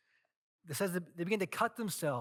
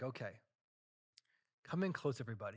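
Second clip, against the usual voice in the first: softer, drawing people in; hushed; 5 semitones lower, 8 dB quieter, faster than usual. Retold or imitated. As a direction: call them close in a low voice. Elijah gathers people quietly.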